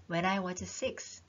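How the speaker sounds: very slow, careful read. Shown as a demonstration. Teacher slowly rereading the text for students. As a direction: read it word by word. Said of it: "rereading the text".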